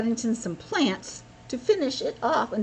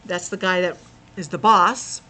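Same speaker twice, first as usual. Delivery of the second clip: plain reading voice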